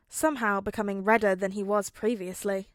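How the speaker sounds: ordinary delivery, nothing marked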